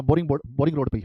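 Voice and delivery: usual speaking voice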